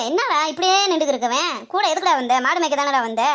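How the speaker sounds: normal talking voice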